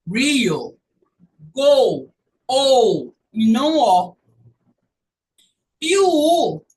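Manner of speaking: "Go" and "Ou" loud with a glide, not plain O; loud teacher drill of ou; diphthong demo. Shown as a demonstration. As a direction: punch "Ou" against "O". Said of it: "Eu vou para o Rio".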